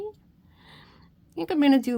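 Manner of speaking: half laughing at her own tears; tearful. Real, not acted. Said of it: "make me cry".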